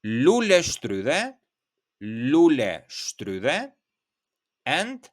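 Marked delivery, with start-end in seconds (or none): 0.0-3.7 lule shtrydhe stretched out at half speed; slow lule shtrydhe down for review; slow review demo. Shown as a demonstration. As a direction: lule shtrydhe modelled slowly. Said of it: "So once again".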